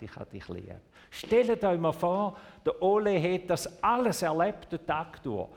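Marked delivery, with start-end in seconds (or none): 0.0-0.8 much softer, low and tender; soft tender murmur. Acted. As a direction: say it like a mother at bedtime. The voice that says I love you.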